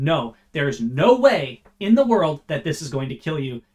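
loud, hard emphasis on "no way"; intensified denial. Acted. Satan assuring Eve the fruit won't kill her, emphatic stress on "no way". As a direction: punch "no way" to dismiss any danger. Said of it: "intensifying them even more".

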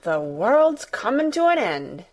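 gasped amazement. A narrator's reading. Martha stunned by Mary's tale, mild gasping amazement. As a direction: exclaim it, mildly thunderstruck. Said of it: "gasped Martha".

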